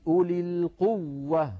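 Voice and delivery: slow, firm teacher's correction on القوة; imitating the sheikhs. Imitating the sheikhs correcting a reciter's القوة. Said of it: "المشايخ على طول يقولون بين التشديد".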